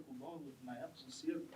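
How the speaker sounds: ordinary talk, nothing marked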